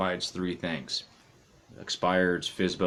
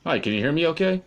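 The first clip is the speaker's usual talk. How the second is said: much louder and higher, almost shouting; calling out; 9 semitones higher, 7 dB louder, faster than usual. Real, not acted. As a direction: project it down a bad phone line. Caller still can't hear him.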